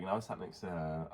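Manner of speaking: ordinary delivery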